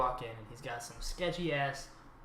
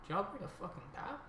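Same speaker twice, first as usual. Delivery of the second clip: softer, higher question trailing away; 5 semitones higher, 8 dB quieter than usual. Retold or imitated. Dealer asks friends about the new kid, trail-off voice. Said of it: "like, that trail off voice".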